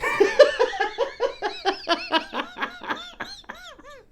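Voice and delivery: high, helpless wheezing laughter; long laughing fit. Real, not acted. Recalling the cellmate reading the letter, helpless laughter. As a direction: let the laugh run until it is gone. Laughing at his own story.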